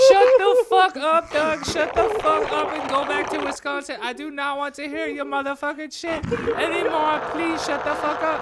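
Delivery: angry shouting. Acted. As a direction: scream at the husband to shut up. Martha yells at husband.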